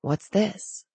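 softly voiced, hushed question; hushed, softened narration of a whisper. A narrator's reading. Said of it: "Zane whispered".

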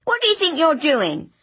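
high, muffled behind a scarf; muffled demand. A narrator's reading. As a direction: demand through a scarf over the mouth. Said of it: "demanded a muffled voice".

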